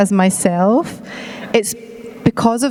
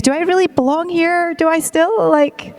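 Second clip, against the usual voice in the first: anxious inner voice; 7 semitones higher than usual. Retold or imitated. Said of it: "you think".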